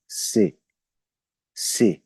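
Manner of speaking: से said as tsay; German letter C. Shown as a demonstration. Teacher drills German C, tsay sound. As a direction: repeat से the German way. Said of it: "सी को हम pronounce करते हैं से".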